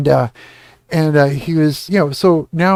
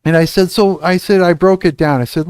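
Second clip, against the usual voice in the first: ordinary delivery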